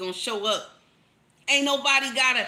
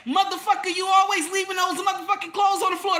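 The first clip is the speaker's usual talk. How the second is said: higher, louder angry shout; angry yell; 7 semitones higher, 4 dB louder than usual. Shown as a demonstration. Wife yelling at husband about clothes, angry shout. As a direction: yell at him with contempt. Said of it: "Don't get up there and say".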